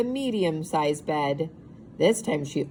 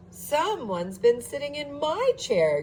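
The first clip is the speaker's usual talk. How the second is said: higher, breathy gasping mother bear; gasping Mama Bear; 8 semitones higher than usual. A narrator's reading. Mama Bear finding her chair used, high gasping voice. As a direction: gasp it out in dismay. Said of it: "gasped Mama Bear".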